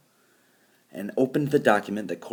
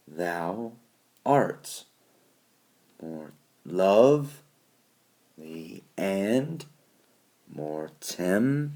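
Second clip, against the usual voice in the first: "thou ART, more LOVE, AND, more TEM", in slow separated pairs; weak syllable, pause, then drawn-out strong one; iambic stress; much slower than usual. Shown as a demonstration. Teacher marking unstressed syllables, iambic stress. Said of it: "Our unstressed, uh, syllables would be".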